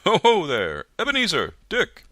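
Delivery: loud, high, hearty calling out; fat jovial voice. A narrator's reading. Fezziwig calling his apprentices, loud and jovial. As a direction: boom it out like a jolly old master. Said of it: "a comfortable, oily, rich, fat, jovial voice".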